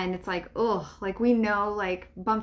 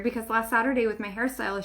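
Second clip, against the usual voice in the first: ordinary talk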